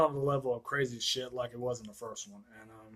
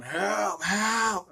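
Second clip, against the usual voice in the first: raised, higher-pitched cry for help; mock yelling for help; 7 semitones higher, 6 dB louder than usual. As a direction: imitate a trapped man calling out. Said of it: "he's, like, yelling".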